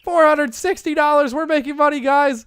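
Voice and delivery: high and loud, crowing about the money; excited. Real, not acted. Gamer tells viewers they're making money, loud and thrilled. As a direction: celebrate the profit to the viewers. Painting sells to gallery.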